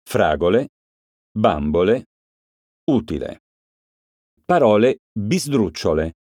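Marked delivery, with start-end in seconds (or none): 0.0-3.4 Fragole, bambole, utile hit on the first syllable; antepenultimate stress. Shown as a demonstration. Teacher showing third-to-last stress to pupils, first syllable stressed. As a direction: punch the first syllable, let the rest drop. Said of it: "cade sulla terzultima sillaba".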